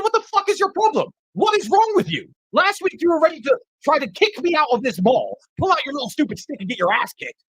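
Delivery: high-pitched, indignant challenge; retold angry confrontation. Confronting the man about last week, indignant retelling. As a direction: confront him, voice pitched well up.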